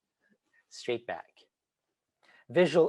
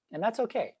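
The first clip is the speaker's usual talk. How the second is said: normal talking voice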